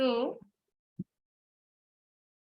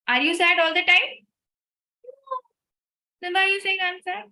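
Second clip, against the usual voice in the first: teasing challenge; 7 semitones higher, 5 dB louder than usual. Real, not acted. Challenging the audience.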